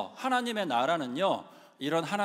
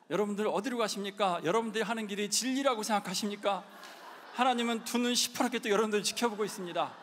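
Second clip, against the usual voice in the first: street preaching shout; 5 semitones higher than usual. Retold or imitated. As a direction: challenge the protesters head-on. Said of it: "이러면서 막 얘기하는 거예요". (시위대 앞에서 몰아붙이듯 외치는 전도)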